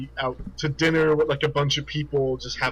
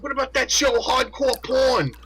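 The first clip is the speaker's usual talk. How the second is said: put-on New York accent; 10 semitones higher than usual. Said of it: "He's like".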